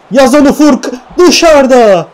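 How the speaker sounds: high, loud cry at a near miss; real commentator outburst; anguished shout. Real, not acted. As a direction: urge the shot in, then cry out. Shot goes wide.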